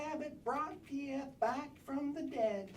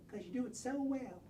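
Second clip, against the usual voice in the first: ordinary talk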